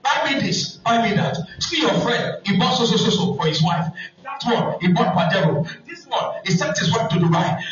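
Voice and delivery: high, excited preaching; animated sermon story. Acted. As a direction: tell the tale with rising heat, high. Preaching story at full force.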